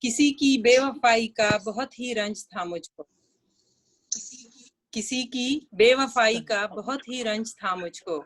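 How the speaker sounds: measured poetry reading with long pauses; unhurried, expressive verse delivery; nazm recitation. Acted. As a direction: recite the poem phrase by phrase, pausing between them.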